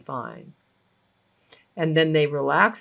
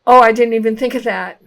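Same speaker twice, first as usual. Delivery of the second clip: sudden realization; 4 semitones higher, 10 dB louder, much faster than usual. Retold or imitated. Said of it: "And they could go".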